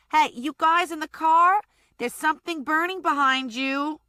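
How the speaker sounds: high and loud call to the car. Acted. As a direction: shout across to the street. Connie calling to the car.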